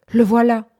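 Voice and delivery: startled exclamation, acted in narration; frightened cry. A narrator's reading. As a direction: cry out as if in dread. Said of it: "cria-t-elle involontairement, comme dans l'épouvante".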